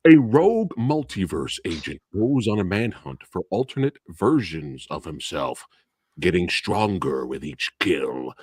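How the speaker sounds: low, slow, dramatic synopsis read; mock trailer narration. Host giving quick plot synopsis, mock-epic narrator. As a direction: draw out the key words like a movie trailer.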